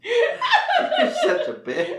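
very high, slow laughing squeal. Real, not acted. Laughing at the story.

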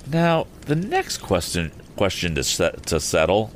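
fluffed reading with a repeated word and false start; stumbling over words. Real, not acted. Narrator botching Tom's next question, tongue-tied and restarting. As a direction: trip over the line midway. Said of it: "I couldn't speak that line".

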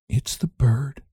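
whispering the awful discovery; whispered. Acted. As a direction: breathe it out under your breath. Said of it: "she whispered".

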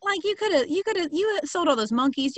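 high, strained, mock-outraged pleading; exasperated whine. Real, not acted. Ranting to cohost about Jim's cheapness, high exasperated whine. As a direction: squeal it like you can't believe the cheapness. Scolding Jim over cheap punch.

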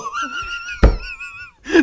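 shrieking laugh, almost crying; high squealing laugh. Real, not acted. Long laugh after the joke.

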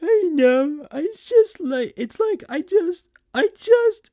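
high falsetto, halting and mock-tearful; trying not to cry. A narrator's reading. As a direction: stammer through held-back tears in falsetto. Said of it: "trying not to cry".

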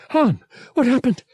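high, loud, anxious question; alarmed. A narrator's reading. Woman bursting into the cabin, alarmed.

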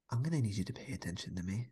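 fast whispered scolding. Real, not acted. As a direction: hiss it fast under your breath. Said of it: "scolds co-host, half joking".

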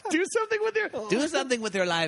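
Speedy Gonzales voice. Retold or imitated. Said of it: "doesn't he say".

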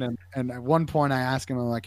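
normal talking voice

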